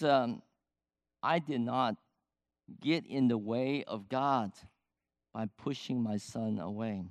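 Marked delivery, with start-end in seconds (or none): none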